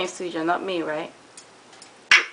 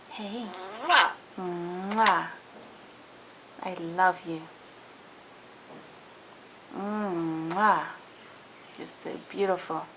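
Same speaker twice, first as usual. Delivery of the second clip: soft, lower kisses and praise; showering her parrot with kisses, soft and lower; tender pet talk; 3 semitones lower, 8 dB quieter, slower than usual. Real, not acted. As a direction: murmur sweetly to the pet. Kissing and praising her bird.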